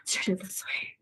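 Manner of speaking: breathy whisper under her breath. Real, not acted. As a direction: whisper it while turning. Whispering while posing.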